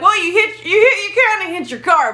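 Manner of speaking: high-pitched, giggly retelling; re-enacted amused explanation, voice lifted high; stifled laughter. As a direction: break it to him while fighting a laugh. Breaking bad news while stifling laugh.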